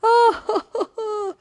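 high, loud mocking laugh; hammed-up derisive "oh-ho-ho"; scornful laughter. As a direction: imitate a crowd laughing in scorn. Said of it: "They laughed him to scorn".

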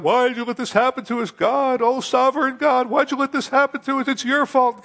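high, loud complaining plea; crying out to God. Acted. The people crying out to God, blaming him in a high plea. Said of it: "He's crying out to God".

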